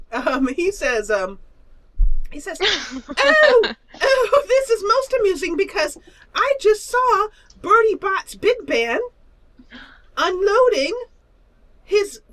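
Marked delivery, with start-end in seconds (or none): 2.6-12.2 high giggly gossip; swooning high little monster; gushing creature voice. Acted. Creature gushes about tuba player.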